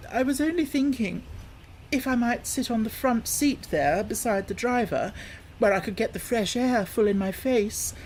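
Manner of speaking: put-on high, wheedling old-woman voice; Toad as washerwoman. Toad disguised asking for the front seat, high wheedling voice. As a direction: coax sweetly from up high.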